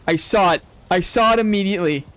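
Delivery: ordinary talk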